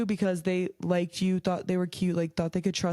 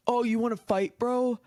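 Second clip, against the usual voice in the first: higher-pitched mock challenge to a fight; mock angry guy; 5 semitones higher than usual. Guy who got mad challenging someone to fight, mock angry. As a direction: imitate a guy squaring up. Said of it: "whenever they get mad".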